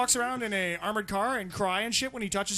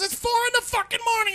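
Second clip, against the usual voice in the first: annoyed pus sucker; 12 semitones higher, 3 dB louder, faster than usual. Retold or imitated. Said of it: "So the guy goes".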